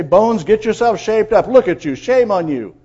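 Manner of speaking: loud finger-shaking telling-off; mock scolding. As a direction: scold them like a stern preacher, loudly. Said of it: "finger shaking and scolding and say".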